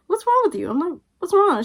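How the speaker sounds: high-pitched, incredulous challenge; retold indignant question. Confronting friend over snubbing mom, indignant.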